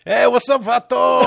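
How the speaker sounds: put-on high, loud Mexican-accent shout; mock Mexican accent. Host greeting a hotline caller, loud mock Mexican accent. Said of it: "English with a Mexican accent".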